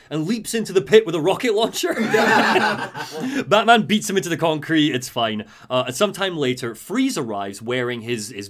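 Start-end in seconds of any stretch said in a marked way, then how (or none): 1.9-4.9 laughing through the words; giggling as he tells it. Real, not acted. Telling friends of the fight, laughing.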